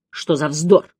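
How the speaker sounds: usual speaking voice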